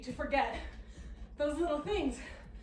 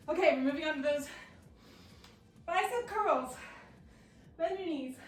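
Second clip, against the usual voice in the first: normal talking voice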